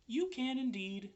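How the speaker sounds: high small voices together; tiny spiders. A narrator's reading. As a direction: spiders agreeing cheerfully. Spiders answer.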